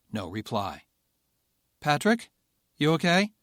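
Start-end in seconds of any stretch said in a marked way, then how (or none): 1.8-3.3 worried call. Acted. Worried check on copilot.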